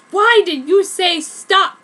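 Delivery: loud, slow angry question; angrily. A narrator's reading. Pai challenges Kishu for stopping the fight, angry. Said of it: "said Pai angrily".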